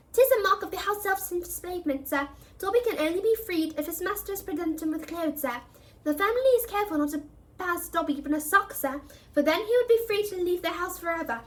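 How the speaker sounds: high, meek little elf; house elf voice. A narrator's reading. The elf answers the threat, high and meek.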